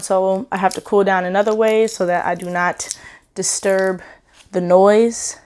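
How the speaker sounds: normal talking voice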